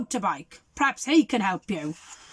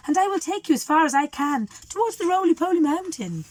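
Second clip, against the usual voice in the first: put-on higher girl's voice; bright helpful girl, pitched up; 7 semitones higher than usual. The girl offering Father Christmas a ride, pitched up.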